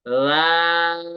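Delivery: "लाल" drawn out slowly; three alif stretch. Shown as a demonstration. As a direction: hold "लाल" for three counts. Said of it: "लाल को हम तीन अलिफ खींचेंगे". ("लाल" को तीन अलिफ खींचें)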